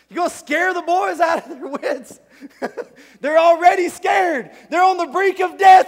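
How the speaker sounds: loud, high, laughing disbelief; laughing exclamation. Pretend. Preacher marvels at Jesus scaring the terrified disciples, laughing loud. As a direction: laugh it out at the top of your voice.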